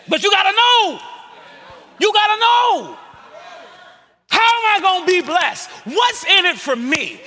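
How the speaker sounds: loud, high preaching voice; fiery sermon delivery; full-force preaching. Acted. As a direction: preach it out hard.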